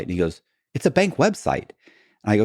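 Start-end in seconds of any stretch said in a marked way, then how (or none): 0.7-1.7 exasperated agent. Retold or imitated.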